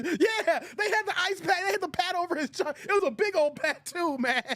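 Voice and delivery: high, amused retelling through laughter; laughing. Real, not acted. Streamer recalling a movie gag with viewers, laughing. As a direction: talk while cracking up.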